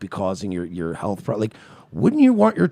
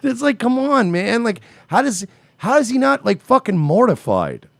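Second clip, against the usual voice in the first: much higher, louder disbelief; incredulous; 13 semitones higher, 5 dB louder than usual. Real, not acted. Host asks loudly how the husband is not mortified. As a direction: cry out in disbelief.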